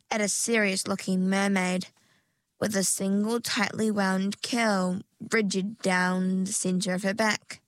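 ordinary delivery, nothing marked

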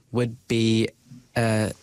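ordinary talk

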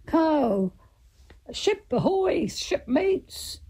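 high, singing sailor's call; pirate hail. Acted. Uncle hailing his shipmate on the pirate ship, calling out.

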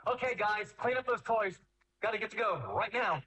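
high, singsong parent call; parent calling to kids. Acted. Parent telling kids to clean up before leaving, high-pitched calling.

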